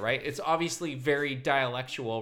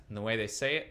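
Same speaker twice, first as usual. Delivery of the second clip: ordinary talk, nothing marked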